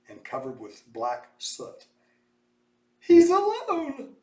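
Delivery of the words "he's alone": high, slow anguished wail; mother screaming. A narrator's reading. Mother screams seeing Mose without her baby, high slow wail. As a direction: wail it in despair. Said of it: "the mother screamed".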